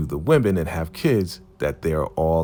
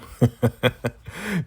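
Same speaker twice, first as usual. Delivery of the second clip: high, quick chuckle; real high-pitched giggle; amused laugh; 7 semitones higher than usual. Real, not acted. Amused at retold problem.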